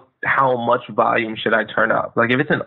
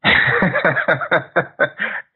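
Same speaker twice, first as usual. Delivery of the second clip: laugh; 6 semitones higher than usual. Real, not acted.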